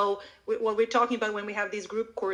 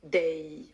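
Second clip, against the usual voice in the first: "Day" alone, lower and drawn out; long, low "Day"; stressed final word; 5 semitones lower than usual. Shown as a demonstration. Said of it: "add the stressed word at the end".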